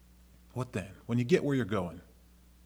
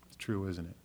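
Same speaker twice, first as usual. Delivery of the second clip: said again much softer, almost to himself; quiet repeat; 7 dB quieter than usual. Shown as a demonstration. Pastor repeats the point softly to the congregation. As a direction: let the question drop to a soft murmur.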